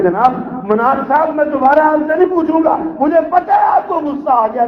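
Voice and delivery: high, fast and anxious, rising to a cry; frightened pleading. Retold or imitated. Old man pleads with his idol in fright. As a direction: beg the angry idol nervously. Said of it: "وہ ڈر گئے".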